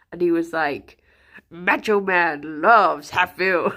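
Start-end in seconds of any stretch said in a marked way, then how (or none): 1.5-3.7 lightly done wrestler promo voice, slow and louder; a hint of gravel-free wrestling bravado, drawn out; mild imitation of a costumed fan in character; Macho Man impression. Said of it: "he stayed fully in character".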